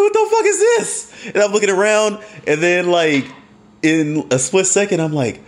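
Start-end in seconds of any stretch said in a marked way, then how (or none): 0.0-1.1 much higher, squeaky what the fuck is this; shocked confusion. Retold or imitated. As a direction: pitch your shock way up. Confused self in water.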